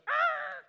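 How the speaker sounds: a very high, loud startled cry; shocked exclamation. Retold or imitated. Pastor reacts to man confessing to his wife, high alarmed cry. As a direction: yelp in alarm at the idea. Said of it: "I said".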